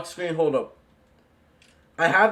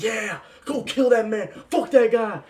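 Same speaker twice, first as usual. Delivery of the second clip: cheering crowd; 7 semitones higher than usual. Retold or imitated. Theater crowd cheering the hero.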